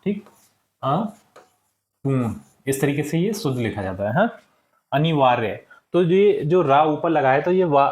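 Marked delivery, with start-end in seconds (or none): 0.8-2.3 अपूर्ण drawn out very slowly, syllable by syllable; slow dictation. Shown as a demonstration. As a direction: spell out अपूर्ण slowly for the class to write. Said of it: "मैं लिख देता हूँ अपूर्ण". (अपूर्ण को बहुत धीरे, एक-एक अक्षर खींचकर बोलें)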